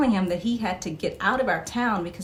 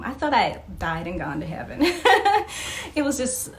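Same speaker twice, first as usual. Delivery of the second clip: ordinary talk, nothing marked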